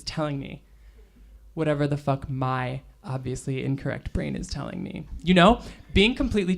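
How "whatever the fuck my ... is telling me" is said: quiet repeat. Acted. Narrator repeating her own inner voice, much softer. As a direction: drop it much softer, as an aside.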